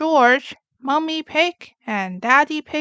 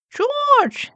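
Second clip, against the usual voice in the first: much higher, loud cross call; woken-up sister; 13 semitones higher, 4 dB louder than usual. Acted. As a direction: shout at little brother for waking her. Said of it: "says Peppa".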